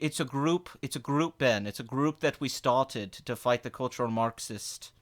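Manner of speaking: low, rapid lecturing with questioning tags; Ben impression. Impressionist as Ben lecturing the streamers, low quick caricature. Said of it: "Oh, Ben's here".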